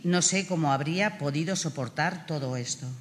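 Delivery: ordinary talk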